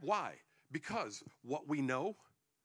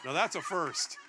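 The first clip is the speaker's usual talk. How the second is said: laughing aside; 4 semitones higher, 11 dB louder than usual. Real, not acted. Reacting to church laughter.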